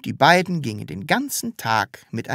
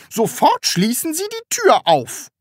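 high, loud command; calling from outside; 5 dB louder than usual. A narrator's reading. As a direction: order them shrilly through the door. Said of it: "rief sie von draußen". (hoher, lauter Befehl durch die Tür)

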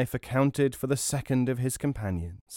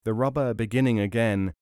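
ordinary delivery, nothing marked

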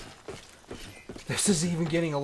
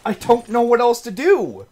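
higher, louder despairing shout; exasperated yell; 10 semitones higher, 11 dB louder than usual. Real, not acted. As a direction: throw it out, at wit's end. Streamer yelling in despair again.

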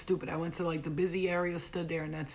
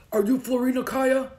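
loud, higher official challenge; barked demand; 6 semitones higher, 9 dB louder than usual. Acted. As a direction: call it out like a soldier confronting someone. Soldiers confronting her, acted.

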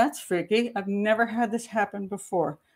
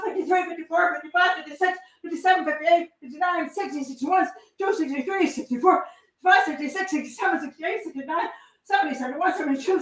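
high, driving, breathless count; relentless re-enactment count; frantic counting; 6 semitones higher, faster than usual. Acted. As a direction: keep pounding out the numbers urgently. Continues counting stabs in re-enactment.

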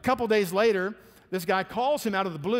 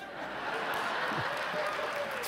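a short breathy laugh at the punchline; soft laugh; 8 dB quieter than usual. Real, not acted. Laughing as the pastor tells the barbell story. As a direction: laugh at your own story.

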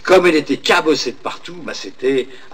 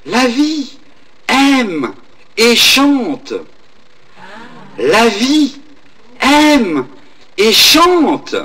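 halting, higher mountain echo with long pauses; 6 semitones higher, much slower than usual. Acted. The mountain's echo answering the old woman, in a distant halting call. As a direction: answer from far away, word by word. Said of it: "l'écho qui lui répondait".

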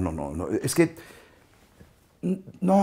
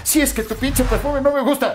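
much higher imitated whine; mock complaining woman; 13 semitones higher than usual. Imitating pregnant co-star griping about his perfume, high-pitched complaint.

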